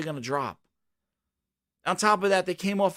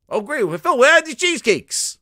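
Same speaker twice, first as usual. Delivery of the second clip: impatient customer; 10 semitones higher, 9 dB louder than usual. Retold or imitated. Customer demands the cheesecakes.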